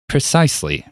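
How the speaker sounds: whiny voice. Acted. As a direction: grate on them with a whine. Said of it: "his whiny voice still grating".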